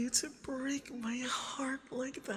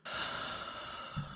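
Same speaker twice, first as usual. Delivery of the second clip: soft, long breathy sigh; hushed exhale mid-plea; yearning sigh; 11 dB quieter than usual. Acted. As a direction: let out a quiet, longing sigh. Pleading with spouse to give in.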